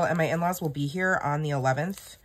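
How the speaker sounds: ordinary delivery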